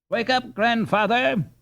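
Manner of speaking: high, teasing call; laughing. A narrator's reading. Ludwig teases the dreamy captain, laughing. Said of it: "with a laughing".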